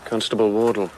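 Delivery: ordinary talk, nothing marked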